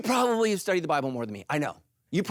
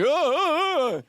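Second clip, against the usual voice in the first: squinting strain; 10 semitones higher, 5 dB louder, slower than usual. Retold or imitated. Mocking friends squinting at bill.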